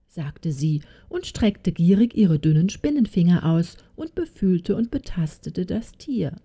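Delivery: ordinary delivery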